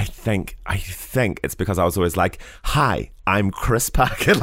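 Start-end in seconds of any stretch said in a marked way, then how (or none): 2.6-4.3 put-on slow, bright flamboyant child voice; flamboyant kid. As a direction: camp it up like an eager kid. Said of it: "like, real, like, flamboyant".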